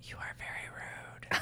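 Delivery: slow mock-hurt whisper. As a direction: hush it, pretending offense.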